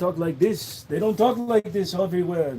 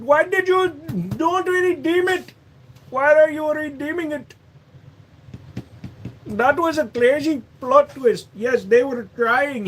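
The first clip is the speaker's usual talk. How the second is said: put-on raised, Indian-accented call-centre voice; a higher Indian accent selling gift-card scams; Indian scam caller accent; 7 semitones higher than usual. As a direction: play an Indian phone scammer pleading with a customer. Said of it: "the Russian accent or the Indian accent".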